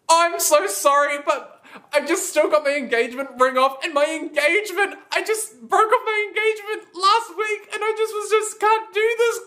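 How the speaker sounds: high, teary mock sobbing plea; fake crying. Lying to the naked couple to escape, fake crying. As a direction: ham up a tearful fake breakdown, pitched high. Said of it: "I fake cried 'cause I can do that at times".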